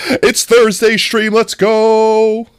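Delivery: high, loud, stretched-out hype call; excited opening shout; hyped up. Real, not acted. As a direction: kick off the show with a cheer.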